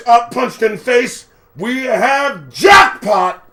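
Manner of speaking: loud, high, drawn-out radio announcement; mock operation triumph. Lookout reporting the punch landed, loud mock triumph. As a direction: announce success to the whole team. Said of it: "sounds as if it was an operation".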